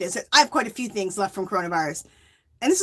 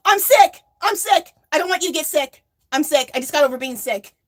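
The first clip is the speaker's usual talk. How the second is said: higher, louder insisting she's sick; frantic fake excuse; 5 semitones higher, 3 dB louder than usual. Warding off the bank lady, higher louder panicked insistence. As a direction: blurt it out in a panic to scare her off.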